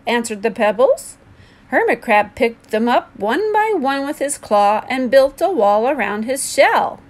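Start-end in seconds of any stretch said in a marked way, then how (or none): none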